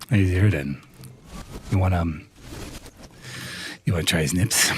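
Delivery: low, close-to-the-mic imitation; deep mock-seductive creator voice; Game God voice. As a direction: lean in and purr it low into the mic. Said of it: "Game God leaning into his microphone going".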